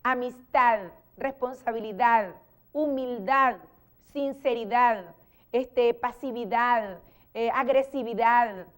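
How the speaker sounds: every word ending dad said with an audible D at the very end; final D sounded. Shown as a demonstration. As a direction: finish amistad, humildad and the rest on a clear D. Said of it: "hay que darle el sonido de la D al final".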